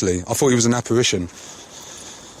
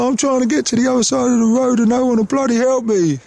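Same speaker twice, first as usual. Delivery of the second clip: high, put-on old man's grumble; old man complaining; 11 semitones higher, 4 dB louder than usual. Old man to a would-be helper, high mock exasperation. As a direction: imitate an exasperated old fella, pitched right up. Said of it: "he was calling for help".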